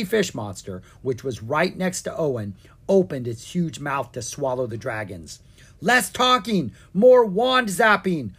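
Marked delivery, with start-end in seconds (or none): 5.8-8.3 high, loud urgent yelp. A narrator's reading. Dragon about to be swallowed urging the wizard, yelping. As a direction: yelp it out in alarm. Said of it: "Owen yelped".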